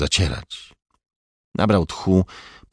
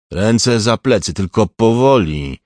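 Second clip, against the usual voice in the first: slow, raised, commanding voice; firm order; 3 semitones higher, 6 dB louder, slower than usual. A narrator's reading. Older officer orders the suspect to comply, firmly commanding. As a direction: give the order loudly and deliberately. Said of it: "polecił jeden ze starszych oficerów".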